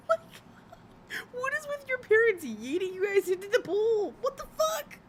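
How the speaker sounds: high-pitched squealing laugh through the words; laughing fit. Real, not acted. Streamer reacting to a parent yeeting kids into a pool, cry-laughing. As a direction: crack up until the voice squeaks. Laughing hard at chat story.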